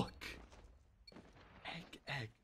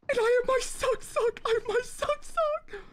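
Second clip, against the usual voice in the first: much higher, squeaky goofy voice; silly high voice. Acted. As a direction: pitch it way up like a cartoon. Jokey voice over his item.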